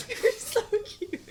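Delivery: high, squeaky laughing coo; laughing fondly. Real, not acted. Cracking up at co-host's bye, high laughing voice. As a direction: gush through helpless laughter.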